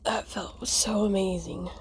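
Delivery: breathy whisper of relief; relieved whisper. Real, not acted. Relief after burping.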